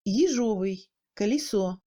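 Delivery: "Ежовый, колесо" with Е between i and é; reduced YE. Shown as a demonstration. Teacher showing reduction, "Ежовый, колесо" with i-like Е. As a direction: shade unstressed Е towards i in "Ежовый, колесо". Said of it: "un son moyen entre le i et le é".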